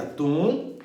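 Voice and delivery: "Tungstênio" broken into tun, guis, tênio; long gaps between the chunks of "Tungstênio"; mock syllable split, tun... guis... tênio; wrongly split tungstênio. Shown as a demonstration. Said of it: "Muita gente separaria".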